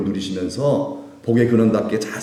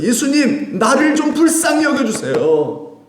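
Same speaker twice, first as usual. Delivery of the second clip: pleading cry pitched much higher, slow and drawn out; 12 semitones higher, slower than usual. Retold or imitated. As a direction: cry out to Jesus for mercy. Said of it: "소리를 질렀어요". (간절히 높게 외치는 목소리)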